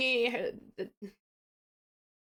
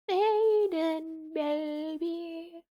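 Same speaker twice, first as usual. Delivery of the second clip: high, tender coo; 6 semitones higher, much slower than usual. Real, not acted. Cooing at game character.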